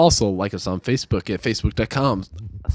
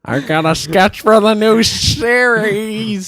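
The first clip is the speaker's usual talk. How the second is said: high, loud mock nerd; slow, high-pitched geek voice; nerdy pitch; 10 semitones higher, 5 dB louder, much slower than usual. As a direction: drag it out like an excited nerd. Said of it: "That would be so nerdy".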